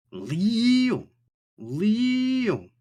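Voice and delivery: high, loud repeated call; shrill alarm call. Acted. Snail alarm blaring at the player.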